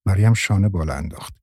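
ordinary delivery, nothing marked

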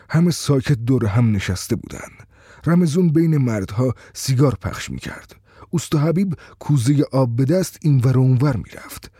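usual speaking voice

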